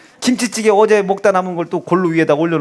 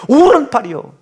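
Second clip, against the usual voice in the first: 오른팔이요 higher and louder; mock proud repeat; 6 semitones higher, 5 dB louder than usual. Repeating the wife's boast to the laughing congregation, proud. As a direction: punch it again proudly.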